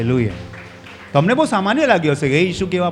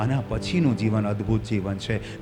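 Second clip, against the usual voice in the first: usual speaking voice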